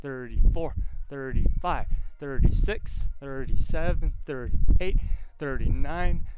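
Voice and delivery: loud, effortful counting through exertion; strained counting. Real, not acted. Streamer counting aloud while holding an exercise, strained and loud. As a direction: push each number out under strain.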